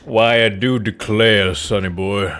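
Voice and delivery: put-on old Southern gent, low and easy; southern old man. Southern old man voiced in game.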